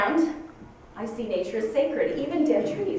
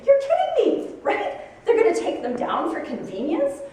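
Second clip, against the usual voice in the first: high, louder incredulous exclamation; retold outrage, high and loud; disbelief; 8 semitones higher, 5 dB louder than usual. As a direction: act out the shock, pitched way up. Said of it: "Yeah, that was my reaction".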